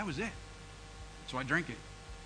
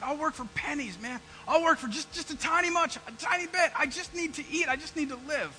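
pleading higher; 7 semitones higher than usual. Retold or imitated. Desperate plea to the farmer.

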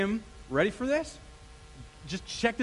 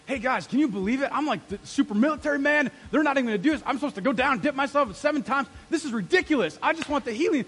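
higher, louder griping to his team; mad Naaman; 5 semitones higher, 5 dB louder than usual. Acted. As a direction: act out a wounded big shot complaining. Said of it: "Naaman goes away mad".

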